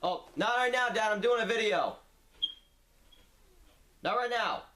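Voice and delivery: calling out. Real, not acted. Calling back to dad offscreen.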